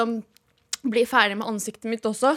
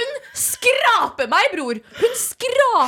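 high, slow laughing retelling; half laugh, half wail it out; stretched laughing complaint; laughing while telling; 9 semitones higher, slower than usual. Retold or imitated. Retelling painful facial scrape.

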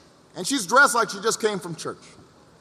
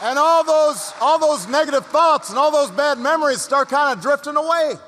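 high, raised voice over a roaring crowd; fired-up rallying; 6 semitones higher than usual. Real, not acted. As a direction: build the room up, rousing and emphatic. Rousing climax over cheering crowd.

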